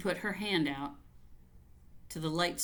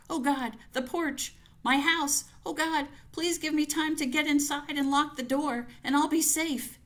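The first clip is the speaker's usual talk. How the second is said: much higher and louder, frantic begging; desperate plea; 9 semitones higher, 4 dB louder than usual. Acted. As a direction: plead to get inside in time. Reaching the porch in terror.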